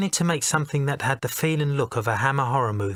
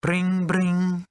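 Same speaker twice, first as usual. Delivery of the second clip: high mock ring of a telephone; phone ring imitation; 6 semitones higher than usual. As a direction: sing out the ring like an old phone.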